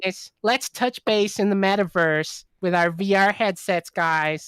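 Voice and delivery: hammed-up American office-boss accent; American middle-manager accent. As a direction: chivvy the staff like an American manager. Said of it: "that's my American middle manager accent".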